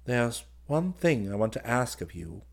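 ordinary delivery, nothing marked

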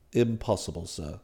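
low, soft, composed refusal; unruffled valet. Acted. Valet refuses master's plea, unruffled. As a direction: refuse quietly in a deep manservant voice.

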